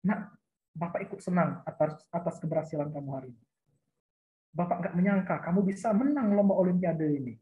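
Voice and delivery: calm, low-pitched encouragement; warm affirmation. Shown as a demonstration. Models praising a student as counselor.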